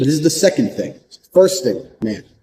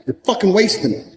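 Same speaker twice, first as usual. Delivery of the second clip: ordinary talk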